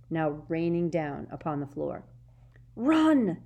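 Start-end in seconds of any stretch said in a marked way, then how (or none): 2.7-3.4 loud urgent yell. A narrator's reading. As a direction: scream it out. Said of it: "Harry yelled".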